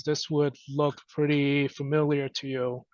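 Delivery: normal talking voice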